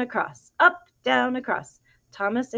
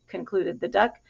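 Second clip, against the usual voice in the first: plain reading voice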